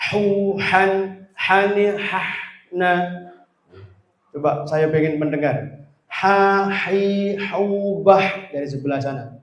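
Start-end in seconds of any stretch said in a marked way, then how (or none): 0.1-2.9 higher "Hu, han, hani, ha, na" with forceful airy h; pressed throat ha. Shown as a demonstration. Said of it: "ini perlu penekanan ya". 6.1-8.5 higher "Ha, hi, hu, bah" with forceful breathy h; pressed throat ha. Shown as a demonstration. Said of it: "ini perlu penekanan ya".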